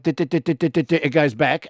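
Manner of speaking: quick dit dit dit clicks, mouth-made; playful mock click sounds; imitated clicking. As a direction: tick it off like a reel ratcheting back. Imitating the hose reel clicking.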